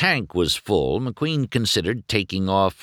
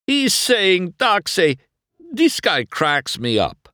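high, loud, tickled voice; acted uproarious amusement; hysterical amusement; 12 semitones higher, 4 dB louder than usual. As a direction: say it as if cracking up. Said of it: "was laughing hysterically".

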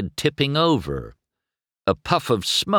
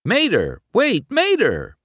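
high, urgent call; anxious high-pitched cry to wait; calling after someone; 11 semitones higher than usual. A narrator's reading. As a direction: call out to a friend leaving you behind. McQueen calls after departing Mater.

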